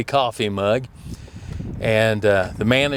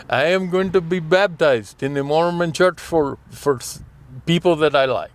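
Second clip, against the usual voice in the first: put-on Indian accent, pitched up; imitated Indian accent; 6 semitones higher than usual. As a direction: mimic the Indian customer's accent. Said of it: "I'm gonna do the accent".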